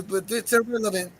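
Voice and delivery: ordinary talk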